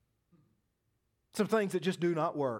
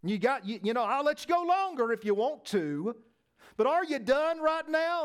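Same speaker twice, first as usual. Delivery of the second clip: mocking challenge; 8 semitones higher, 4 dB louder than usual. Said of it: "now Elijah says".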